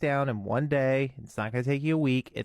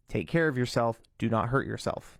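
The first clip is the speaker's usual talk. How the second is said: normal talking voice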